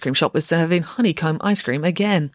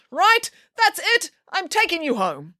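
outraged; 12 semitones higher than usual. A narrator's reading. Pig nanny outraged, storms out.

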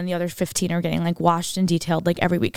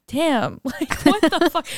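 mock disbelief; 7 semitones higher, slower than usual. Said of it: "And I'm like".

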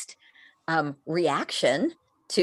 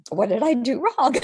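laughing victim quote; 6 semitones higher than usual. Retold or imitated. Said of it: "this little weird sliver".

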